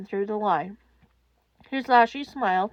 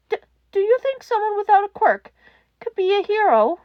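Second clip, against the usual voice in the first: small voice through tears; tearful child; 10 semitones higher, 5 dB louder than usual. A narrator's reading. Frightened child calls for the radio host, crying.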